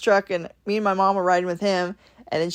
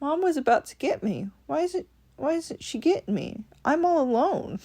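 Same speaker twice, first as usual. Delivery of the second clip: softer, higher little lonely dog; the dog left in the car, softly and pitifully; sad dog voice; 4 semitones higher, 8 dB quieter than usual. Pretend. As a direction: voice the abandoned pup, small and pitiful. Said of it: "sitting there like".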